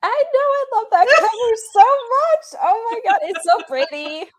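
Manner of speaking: high, loud squeal of delight; real squealing enthusiasm, very high; excited gushing. Real, not acted. Guest gushes over cover.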